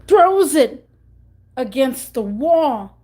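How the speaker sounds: spike of outrage in the telling; dramatic burst. Real, not acted. Phone smashed against the wall.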